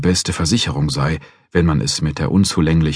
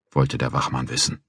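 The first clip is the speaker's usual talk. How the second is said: ordinary delivery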